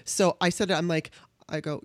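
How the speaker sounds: ordinary delivery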